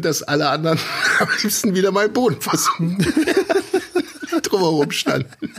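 talking through laughter; laughing while talking. Real, not acted. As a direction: laugh out the words. Recalling embarrassing hotel scene.

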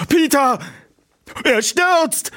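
high, loud cry of alarm; shocked as he falls; horrified cry. Acted. Bob crying out as Peter crashes, high horrified.

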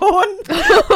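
a high burst of laughter; loud cackling laugh. Real, not acted. Telling her friend about the pushy boy, loud cackling laugh. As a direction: crack up loud.

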